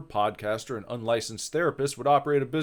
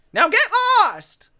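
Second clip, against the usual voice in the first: very high, loud shout; shouted dismissal; 5 dB louder than usual. Acted. Ghost to the reaper, shouted dismissal. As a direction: yell them away.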